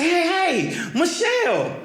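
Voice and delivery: high excited call with a laugh; eager greeting. Retold or imitated. Man spotting his college crush at a bar, high excited calling. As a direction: call her name like a thrilled fan.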